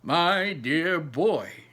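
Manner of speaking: put-on slow, drawn-out fond old man; Grandpa Joe. Grandpa Joe addressing Charlie from his pillow, slow and fond. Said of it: "said Grandpa Joe".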